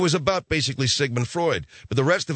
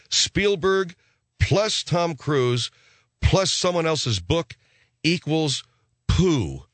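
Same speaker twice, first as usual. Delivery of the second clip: slow equation; 3 semitones higher, much slower than usual. Acted.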